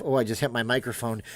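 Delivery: ordinary talk, nothing marked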